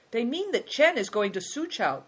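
proud. A narrator's reading. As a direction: say it with pride.

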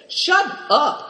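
high, loud snap; fierce cry. A narrator's reading. Yuchi silencing young Simbilik at the fire with a fierce shout. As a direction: cut him off fiercely. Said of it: "cried Yuchi fiercely".

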